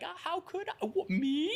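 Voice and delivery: high, mock-innocent whine. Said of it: "I love that you're saying, like".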